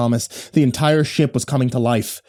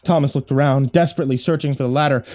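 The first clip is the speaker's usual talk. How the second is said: plain reading voice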